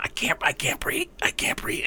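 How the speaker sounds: unvoiced, fading "I can't breathe"; breathless whisper. Man in chokehold on ground, faint whisper. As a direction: whisper it like a dying man choking. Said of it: "laying on the ground going".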